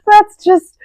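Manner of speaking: high, loud, helpless laughter through the words; laughing hard. Real, not acted. Explaining the laughing fit to the other speaker, laughing hard. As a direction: squeal it out while cracking up. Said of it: "I'm just laughing".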